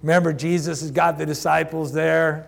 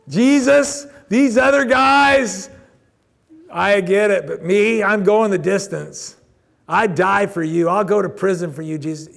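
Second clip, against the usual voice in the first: loud, high, eager vow, slow at first; boastful Peter; 4 semitones higher, 7 dB louder than usual. Retold or imitated. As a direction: swear loyalty loudly and proudly like brash Peter. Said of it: "Peter says".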